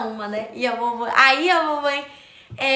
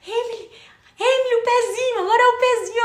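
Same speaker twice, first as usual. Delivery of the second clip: high-pitched teacher prompting a small pupil; imitated ballet teacher; 11 semitones higher, 4 dB louder than usual. Teacher cues the frozen child about her foot, high-pitched imitation. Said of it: "Aí a professora fazendo sinal".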